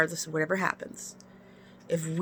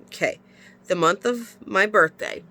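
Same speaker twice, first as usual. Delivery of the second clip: normal talking voice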